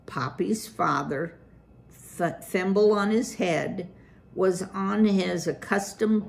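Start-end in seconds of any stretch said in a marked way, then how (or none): none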